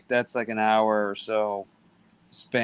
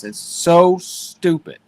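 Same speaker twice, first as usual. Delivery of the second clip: slow, drawn-out and louder, fed up; exasperated rant; 5 semitones higher, 5 dB louder, slower than usual. Real, not acted. Streamer griping to his guest about his useless iPad, exasperated and drawn out. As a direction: stretch it out like you're sick of the thing.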